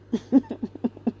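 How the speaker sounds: quiet little laugh; short dry chuckle. Real, not acted. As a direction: chuckle briefly to yourself. Laughs alone after sarcastic remark.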